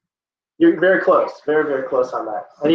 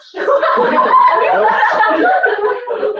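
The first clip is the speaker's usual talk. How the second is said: loud high laugh; 4 dB louder than usual. Real, not acted. Teacher cracking up at a student's guess with a high laugh.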